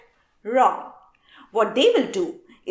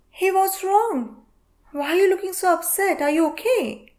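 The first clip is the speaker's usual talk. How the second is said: fake caring gossip; 9 semitones higher than usual. Gossip collector fishing for juicy news, fake sweet concern. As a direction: sweetly feign concern to pry. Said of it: "Gossip collectors will pretend to care".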